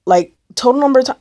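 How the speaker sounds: ordinary delivery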